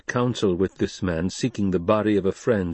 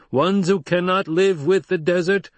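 high, raised objector's voice; challenger from above; 10 semitones higher, 5 dB louder than usual. Acted. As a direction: challenge the leader from a distance. Challenger argues from the rocks.

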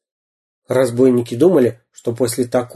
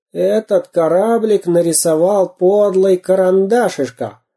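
голос Дырки; 7 semitones higher, slower than usual. Acted. Реплика разбойника Дырки.

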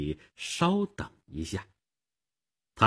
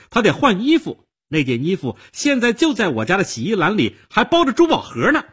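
heated accusation climbing higher and faster; angry exposé; 6 semitones higher, 3 dB louder than usual. Acted. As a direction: spill everything in rising outrage. Jilted woman furiously exposes lover.

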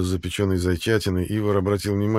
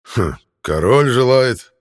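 loud, slow, mocking anger; angry; 4 semitones higher, 8 dB louder, slower than usual. A narrator's reading. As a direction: spit the words back in anger. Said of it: "Артур зло". (зло и насмешливо)

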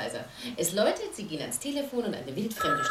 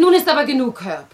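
loud, higher, cutting him off firmly; sharp rebuke; 8 semitones higher, 12 dB louder than usual. Acted. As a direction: stop him mid-sentence, loud and scolding. Wife cuts Herb off sharply.

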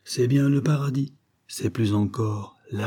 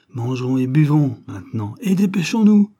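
louder, rising to a high cry on the hurry; eager urging; 3 semitones higher, 6 dB louder than usual. A narrator's reading. Friend urging the narrator to eat fast, loud and high. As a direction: rush them to eat and drink. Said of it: "me dit-il".

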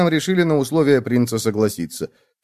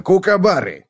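high, hearty confirmation; high emphatic hamster voice; agreeing hamster; 8 semitones higher than usual. A narrator's reading. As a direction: confirm it brightly, pitched high. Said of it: "согласился хомяк".

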